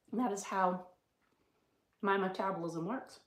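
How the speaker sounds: talking while chewing; words round a bite of berries; mouth full. Real, not acted. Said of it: "I shouldn't be talking with my mouth full".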